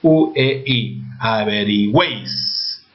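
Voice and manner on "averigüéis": Averigüéis slowly, with güéis as one gliding syllable; single-syllable glide. Shown as a demonstration. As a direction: stretch Averigüéis as a-ve-ri-GÜÉIS, vowels joined at the end. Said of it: "Está sonando como un solo sonido". (Averigüéis lento, con güéis en una sola sílaba)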